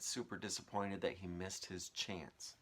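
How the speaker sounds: plain reading voice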